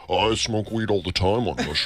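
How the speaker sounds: mocking impression. Truffle seller mimicking the American to coworkers, dopey mock voice. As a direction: play the clueless tourist being mocked. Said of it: "doing an impression of him making fun of me".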